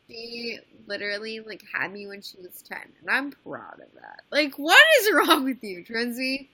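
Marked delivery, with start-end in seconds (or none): 4.3-6.5 exasperated scolding. Real, not acted. Scolding friend for gross joke.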